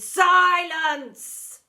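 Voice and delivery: huge, high, furious shout; shouted silence. A narrator's reading. Deputy silencing the whole school, a huge shout. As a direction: blast the word to silence a hall. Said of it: "Miss Seeth shouted".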